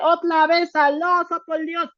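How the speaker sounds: Spanish in a mock Chinese accent, the R turned to an L. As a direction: say it like a Chinese speaker swapping R for L. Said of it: "El chino te dice".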